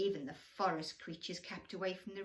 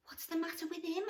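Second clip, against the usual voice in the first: higher, curious little deer voice; lightly voiced deer; 10 semitones higher, faster than usual. A deer asks the others, lightly voiced. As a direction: ask it gently like a timid deer. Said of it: "asked a deer".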